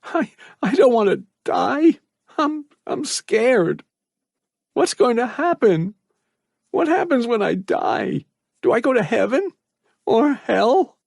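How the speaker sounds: put-on trapped boy stuttering in fear of death; scared stutter. A narrator's reading. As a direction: stammer out the fear of dying. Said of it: "He stuttered".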